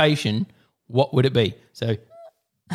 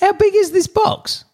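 incredulous; 3 dB louder than usual. Real, not acted. Incredulous question.

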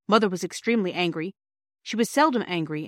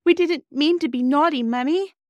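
put-on higher and louder, a child pleading in tears; hammed-up tearful plea, pitched up; mock sob; 8 semitones higher, 6 dB louder than usual. Bobby to Mother after the crying party, higher and louder in mock sobs. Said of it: "sobbed Bobby".

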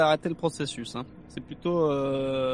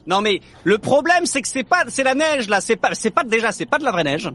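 too much caffeine; 5 semitones higher, 5 dB louder than usual. Real, not acted. Frustrated rant about fake snow.

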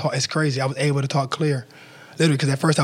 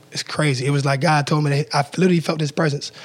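usual speaking voice

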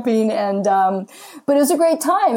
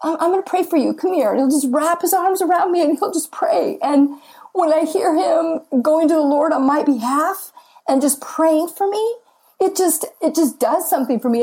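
emotional, voice lifted higher as tears come; talking through real tears with a pitch well above her usual; choked up; 8 semitones higher than usual. Real, not acted. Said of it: "I'm getting choked up".

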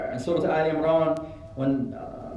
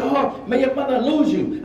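high-pitched mock curse; cursing old lady; 8 semitones higher than usual. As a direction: imitate an old woman's scolding. Old lady's curse acted out.

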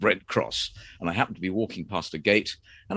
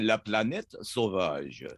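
slow careful French pronunciation; French accent; 6 dB quieter, slower than usual. Shown as a demonstration. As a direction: say it like a Frenchman, slowly. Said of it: "So you say it with a French accent".

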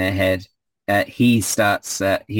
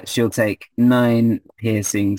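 ordinary delivery

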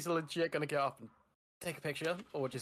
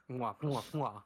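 double air kiss; slower than usual. Pretend. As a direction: blow kisses at the mic. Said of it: "gets a little kiss from me".

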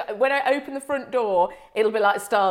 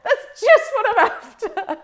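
mock delight, laughing and higher; gushing fake thanks while cracking up; sarcastic through laughter; 8 semitones higher, 5 dB louder than usual. Telling her co-host about the salon call, laughing sarcastically. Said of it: "I thought".

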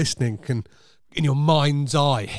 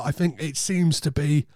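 ordinary talk, nothing marked

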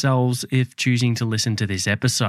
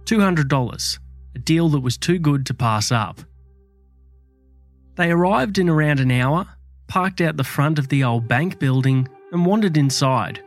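true crime narrator. A narrator's reading. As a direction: tell it like a dark true crime tale.

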